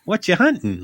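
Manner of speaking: high, bright mock-cheerful teacher. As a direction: chirp it like an easygoing shop teacher. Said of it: "the shop would be like".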